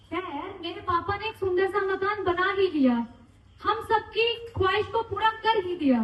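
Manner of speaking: declaimed tribute poem, lines falling at the close; heartfelt stage recital with repeated refrains; poem recitation. Acted. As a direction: recite it to an audience, pausing after each line. Recites own retirement poem for father.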